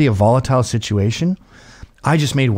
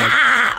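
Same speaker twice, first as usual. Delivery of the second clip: a hammed-up crowd shriek; imitated scream; 9 semitones higher than usual. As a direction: shriek it like the crowd, half in jest. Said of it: "that's how they were screaming".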